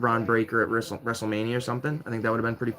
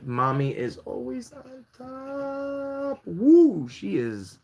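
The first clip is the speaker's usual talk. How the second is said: gushing catchphrase; 12 semitones higher, much slower than usual. Pretend. Fan gushing over a favorite champion, high and stretched.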